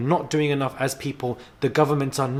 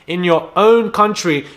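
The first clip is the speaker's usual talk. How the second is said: "own" hit hard, higher, louder and slower; angry repeat; 6 semitones higher, 10 dB louder, slower than usual. Real, not acted. Vlogger to the actress, repeating her failure, angry shout. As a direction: shout it back at her, slower the second time.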